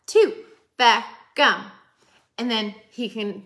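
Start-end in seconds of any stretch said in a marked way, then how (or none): 0.8-1.6 Beckham, louder, two clapped syllables; clapping a name. Shown as a demonstration. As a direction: clap out Beckham in two beats. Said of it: "How many is that?".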